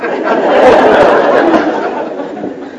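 a high, airy burst of laughter; high breathy laugh. Real, not acted. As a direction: laugh at your own joke. Laughs at own story.

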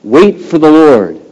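high, loud, stretched-out exhortation; climactic preaching. Real, not acted. Preacher urging believers to hold on to the Lord, climactic preaching. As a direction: build to a ringing pulpit cry.

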